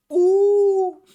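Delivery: a shrill, loud crowd "ooh"; loud high whoop for the ogre. Acted.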